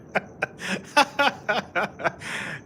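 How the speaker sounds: long, high laughing fit; real laugh. Real, not acted. Streamer joking about being brown, long high laughter. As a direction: keep giggling helplessly in a high pitch. Cracking up at own tan joke.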